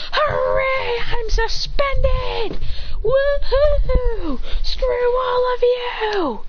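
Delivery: very high, whooping sing-song cheer; gleeful mock celebration. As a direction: whoop and crow like a kid let loose, hammed up. Suspended boy gleefully celebrates.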